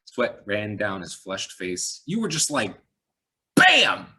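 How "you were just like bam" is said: loud, high bam burst; excited. A narrator's reading. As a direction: act out the gunshot with glee. Cop gushing over her shot.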